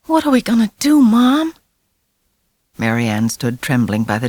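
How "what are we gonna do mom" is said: put-on high, scared little boy; frightened child. A narrator's reading. Little boy asking his mother in fear. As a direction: ask Mom in a panic. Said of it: "his eyes wide with fear".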